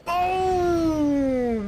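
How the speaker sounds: loud high shout of glee; real excited shout; boom. Real, not acted. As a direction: yell the boom like a fan. Explosion goes off.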